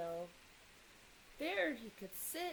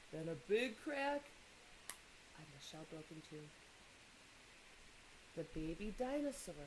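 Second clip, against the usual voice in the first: ordinary delivery, nothing marked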